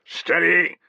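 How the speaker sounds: a veteran officer steadying nervous troops; centurion rallying his men. Acted.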